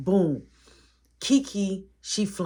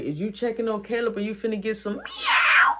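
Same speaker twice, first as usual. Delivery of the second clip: loud teasing call, a bit higher; teasing shout; 5 semitones higher, 3 dB louder than usual. Retold or imitated. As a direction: yell the tease across the yard. Friend teasing loudly.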